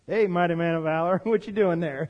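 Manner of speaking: low, casual teasing; mock-chummy jab with a chuckle; sarcastic. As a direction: needle them with an offhand greeting. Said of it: "Is God being sarcastic?".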